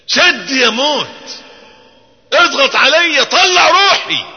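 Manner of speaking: very high, loud, slow desperate cry; pleading with death. Retold or imitated. The preacher voicing dying Hudhayfa to death, anguished cry. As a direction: beg death to take your soul, straining. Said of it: "ثم قال".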